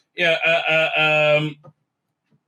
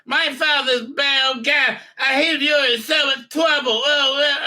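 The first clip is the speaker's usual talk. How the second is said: put-on high, taunting kid's voice, loud at the end; imitating the young princess taunting her captor; bratty little girl; 10 semitones higher, 4 dB louder than usual. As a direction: taunt him like a smug child.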